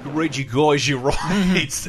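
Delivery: slow, drawn-out mock of Mel Gibson's faked American accent. Host parodies the movie clip's cop line: slow mock American accent. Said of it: "L A's Mel Gibson".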